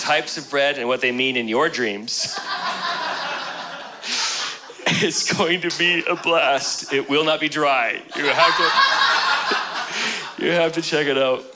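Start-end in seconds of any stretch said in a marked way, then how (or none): 2.4-4.8 high airless laugh, fading; breathless silent laugh. Real, not acted. Laughing at his own joke. 8.6-10.3 breathy laugh. Real, not acted. Laughing mid-sentence.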